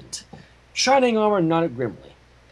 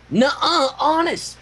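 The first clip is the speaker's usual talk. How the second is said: higher, defensive little dragon; protesting Spike; 10 semitones higher, slower than usual. Acted. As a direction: protest your innocence a bit too fast. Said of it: "protested Spike".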